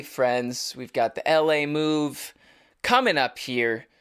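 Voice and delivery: ordinary delivery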